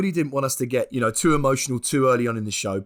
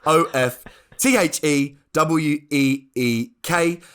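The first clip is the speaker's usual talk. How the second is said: spelling it out. Shown as a demonstration. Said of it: "clarify that spelling".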